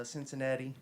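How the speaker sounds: normal talking voice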